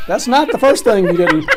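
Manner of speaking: loud laughing delivery of a joke; laughing punchline. Real, not acted. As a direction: deliver the zinger while cracking up. Punchline told through laughter.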